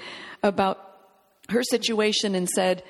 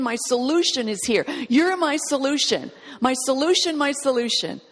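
raised, insistent declarations, one after another; fervent pleading; 3 semitones higher than usual. Acted. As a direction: press in with urgent, hungry faith. Voicing the woman pressing in.

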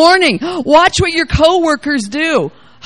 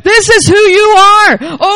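higher, louder, slow commanding proclamation; voice of God; 6 semitones higher, 5 dB louder, slower than usual. Acted. God speaking to the harassed believer, slow commanding call. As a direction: declare it slowly as God speaking. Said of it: "God is saying".

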